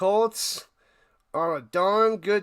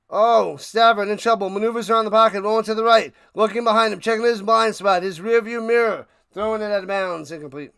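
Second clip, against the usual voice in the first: louder, brisk play-by-play call; sportscaster calling the play; live play-by-play; 2 semitones higher, 6 dB louder, faster than usual. Acted. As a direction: call the action like a broadcaster.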